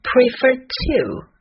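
slow down and lean on Prefer to; a teacher's slow, lifted Prefer to; emphatic, slowed. Shown as a demonstration. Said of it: "比较的两件事物都说出来".

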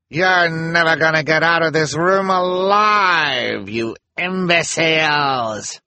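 high, slow, loud sneering villainess; horrible voice. Acted. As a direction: drag out the insult with menace. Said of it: "in her horrible voice".

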